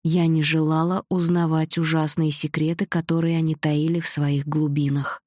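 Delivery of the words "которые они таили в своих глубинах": usual speaking voice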